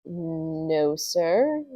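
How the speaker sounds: soft "No, sir" rising into a question. Acted. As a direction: lift "sir" at the end as if asking, quietly. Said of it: "He said it like a question".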